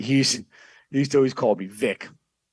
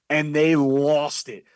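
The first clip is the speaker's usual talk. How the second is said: usual speaking voice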